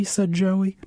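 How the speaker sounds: plain reading voice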